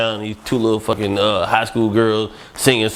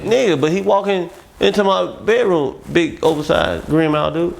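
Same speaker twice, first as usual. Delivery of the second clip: indignant protest; 7 semitones higher than usual. Retold or imitated. Protesting to bodyguard.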